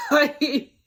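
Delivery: big laugh breaking out; loud burst of laughter. Real, not acted. Reacting to Cody's Southern voice, loud laughter.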